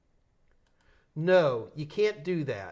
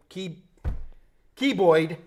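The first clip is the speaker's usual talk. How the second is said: put-on louder New York accent on keyboard; 3 semitones higher, 5 dB louder, slower than usual. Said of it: "That's my New York accent".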